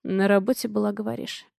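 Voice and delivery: cold, quiet, menacing; icy hissed question. A narrator's reading. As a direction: let it out through clenched teeth. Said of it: "Цедит он ледяным тоном".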